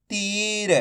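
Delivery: "तीर" with a long stretched ee; drawn-out vowel. Shown as a demonstration. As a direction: hold the ee in "तीर" before the r. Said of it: "खींच के पढ़ेंगे".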